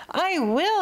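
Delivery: a sudden high, eager cry; excited cry. A narrator's reading. Santa deciding to take a holiday, excited cry. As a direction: blurt out the decision with fire. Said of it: "he cried with his eyes ablaze".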